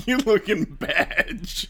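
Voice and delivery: teasing through laughter; laughing. Real, not acted. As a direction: laugh while you tease.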